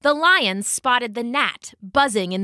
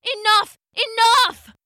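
loud pained yowl, high; 10 semitones higher, 5 dB louder than usual. Acted. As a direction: cry out in pain, loud. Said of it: "the lion yowled".

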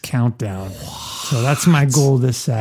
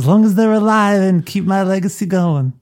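mob boss swearing an oath, raised pitch; higher, put-on godfather voice; Don Corleone; 6 semitones higher than usual. Host voices the Don swearing on his grandchildren, as Don Corleone. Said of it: "Don Corleone swears on the souls".

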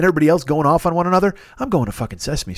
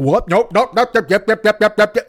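higher, louder panicked yep-yep; freaked-out alien chatter; 7 semitones higher, 4 dB louder than usual. Retold or imitated. Aliens panic at the ringing phone, higher frantic yipping. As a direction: yip in a puppet panic. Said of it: "they freak out".

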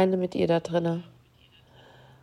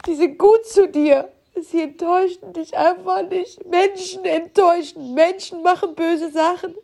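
tearful; 7 semitones higher than usual. Real, not acted. Grieving, says it hurts so much.

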